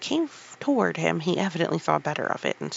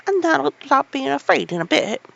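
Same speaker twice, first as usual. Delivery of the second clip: loud, cheerful encouragement; cheery Yorkshire lad, loud; renewed cheer; 9 semitones higher, 9 dB louder than usual. Acted. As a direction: buck him up with cheer. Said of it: "with renewed cheer".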